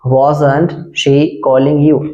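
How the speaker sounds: "wasn't she calling you" drawn out slowly; slow teaching read. Shown as a demonstration. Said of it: "repeated slowly for learners".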